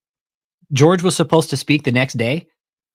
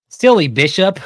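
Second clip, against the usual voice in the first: teasing sing-song jab; mock the bishop lightly, a bit raised; 8 semitones higher, 6 dB louder than usual. Real, not acted. To listeners about the greedy bishop, a light mocking jab.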